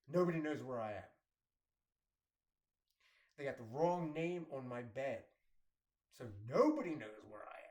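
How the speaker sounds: loud, frantic inner outburst; panicked thought. Retold or imitated. Lost patient realizing nobody can find him, loud and panicked. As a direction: shout it out as the panic peaks.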